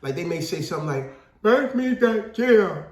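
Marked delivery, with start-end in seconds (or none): 1.4-2.9 slow, higher and louder, off-tone imitation of a deaf speaker; imitating deaf speech. Host mimicking how a deaf person might ask for a chair, slow and off-tone. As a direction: stretch each word out flat and loud like you can't hear yourself. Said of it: "they kinda talk off tone".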